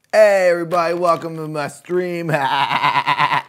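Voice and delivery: high, loud, slow bleating voice; goat-like voice. Retold or imitated. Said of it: "that goat-like".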